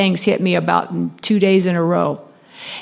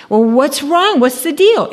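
clerk's question; 6 semitones higher than usual. Retold or imitated. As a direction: play the chirpy salesgirl asking. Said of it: "this girl says".

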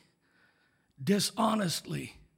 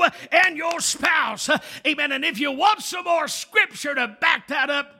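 building from urgent to shouting exhortation, much higher and louder; full-force preaching; 6 semitones higher, 7 dB louder than usual. Acted. Preacher urging the congregation to guard their marriages, rising to a shout. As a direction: climb into a shouted plea to the congregation.

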